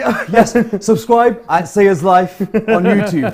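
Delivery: slow laughing plug. Real, not acted. Plugging channel, laughing.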